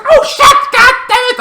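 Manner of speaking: very high, loud cursing yell; shrieking frustrated curse; panicked shout. Retold or imitated. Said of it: "he's like".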